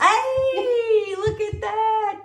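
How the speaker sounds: high, loud, drawn-out cheer; long, high whoop of delight; excited cheer. Real, not acted. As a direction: celebrate the right answer at full voice. Cheering a correct answer.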